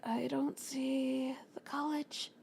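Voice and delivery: hushed, slow worried whisper; whispered worry. Retold or imitated. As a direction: breathe it out to yourself, lost. Lost, muttering to herself.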